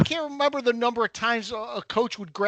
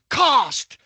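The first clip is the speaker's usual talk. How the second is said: sudden sharp yell across the field, higher and louder; coach yelling a name; 6 semitones higher, 7 dB louder than usual. Retold or imitated. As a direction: call him out from third base. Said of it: "all of a sudden I hear".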